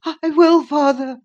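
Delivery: put-on high, breathless frightened boy; high gasped plea; panted. A narrator's reading. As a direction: pant it out in a high, weak voice. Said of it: "he panted".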